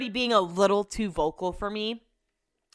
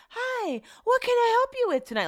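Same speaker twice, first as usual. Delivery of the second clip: much higher, bright customer-service voice; super friendly manager. Retold or imitated. Tall manager greeting the angry customer, sugary and high. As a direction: sell the friendliness, pitched way up. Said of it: "Like, super friendly, super nice".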